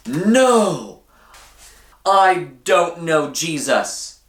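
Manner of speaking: Peter blurts out his denial, slowly; Peter denying. A narrator's reading. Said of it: "What do you think Peter said?".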